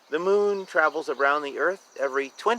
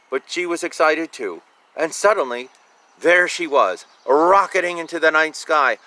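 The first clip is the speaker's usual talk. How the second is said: building excitement, louder read-aloud; story narration; 2 semitones higher, 7 dB louder than usual. A narrator's reading. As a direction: lift it as the rocket takes off. Reading picture book aloud.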